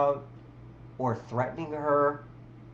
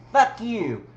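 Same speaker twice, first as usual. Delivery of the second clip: high, loud mock yell; 7 semitones higher, 7 dB louder than usual. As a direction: spit it out like a tantrum. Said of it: "hate and screaming".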